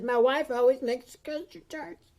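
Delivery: high, wobbly near-crying voice; near tears. Acted. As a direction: choke it out on the verge of tears. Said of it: "Now he's crying".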